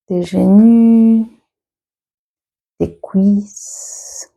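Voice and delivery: unhurried meditation teacher, stretching key words; guided meditation. Acted. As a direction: guide them gently through the body, leaving long pauses. Leads a guided body scan.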